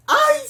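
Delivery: short, high, very loud yelp; sudden piercing fangirl squeal; excited shriek. Real, not acted. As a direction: yelp with delight. Squealing at a romantic line.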